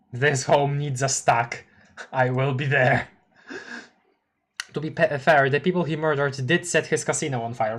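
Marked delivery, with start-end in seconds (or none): none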